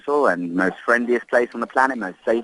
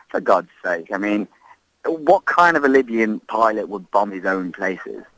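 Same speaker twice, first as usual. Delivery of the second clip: usual speaking voice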